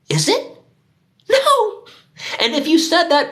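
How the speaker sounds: high-pitched scoffing no; incredulous squeal. Real, not acted. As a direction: shriek the no in disbelief. Mocking Subway sandwich answer.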